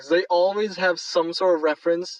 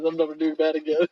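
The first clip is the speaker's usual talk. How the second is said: usual speaking voice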